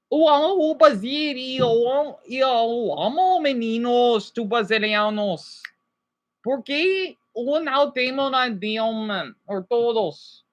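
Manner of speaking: slow Brazilian Portuguese, in a voice unlike her own; speaking Portuguese. Shown as a demonstration. Vlogger shows viewers she speaks Portuguese, slow Brazilian accent. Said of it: "I speak the fucking accent".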